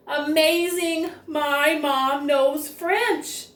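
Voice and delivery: amazed. Acted. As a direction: marvel at mom's French. Nancy amazed mom knows French.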